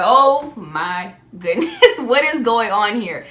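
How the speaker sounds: slow, laughing disbelief; laughing amazement. Retold or imitated. Retelling the dream coincidence, laughing in amazement. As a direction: laugh through the wonder.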